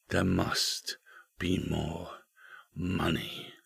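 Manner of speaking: soft, hushed, broken by pauses; quiet haunting murmur; secret whisper. A narrator's reading. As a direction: let each phrase hang in a hush. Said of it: "he had heard the secret whisper".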